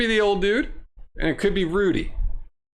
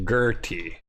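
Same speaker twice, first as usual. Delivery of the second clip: normal talking voice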